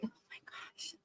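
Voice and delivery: breathless whispered exasperation; mortified whisper. Retold or imitated. Mom reacting to her kids' antics, whispered. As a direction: whisper it like you want to disappear. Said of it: "And I was like".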